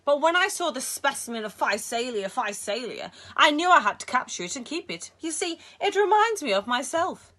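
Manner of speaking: put-on a high, loud Egyptian-accented Sale; lightly done Cairo marketplace accent, proud; heavy Cairo accent. A narrator's reading. Villain Sale shows the boy his jellyfish, Cairo accent. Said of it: "He had a heavy accent brought with him from the Cairo marketplace".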